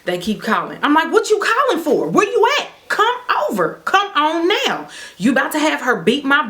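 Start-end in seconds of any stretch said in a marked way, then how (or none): 1.1-4.9 taunting dare. Retold or imitated. She dares ex and girlfriend to come over, high mocking taunt. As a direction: dare them up high, half laughing.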